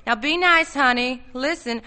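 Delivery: mocking. Arnold mocking her threat.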